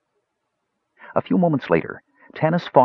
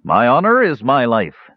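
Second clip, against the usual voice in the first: solemn oath; 5 semitones higher, 5 dB louder than usual. Acted. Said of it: "Sturm Brightblade quoting the Solamnic oath".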